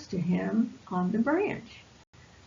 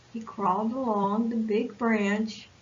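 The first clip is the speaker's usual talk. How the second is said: plain reading voice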